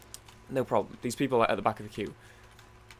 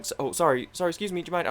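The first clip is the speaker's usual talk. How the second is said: higher-pitched polite excuse-me; apologetic; 7 semitones higher, faster than usual. Retold or imitated. Traveller weaving through a crowd, apologetic. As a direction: apologise while squeezing past people.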